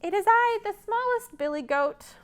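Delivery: high, small goat voice; timid little goat answering; tiny billy goat. Acted.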